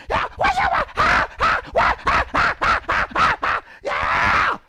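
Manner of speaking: shrieking very high and loud with cackling laughs; hammed-up loud woman cackling. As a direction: play a brash loud woman at work. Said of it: "a loud woman".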